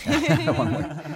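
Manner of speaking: one way said through a laugh. Real, not acted. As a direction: laugh the words out. Laughing while repeating the phrase.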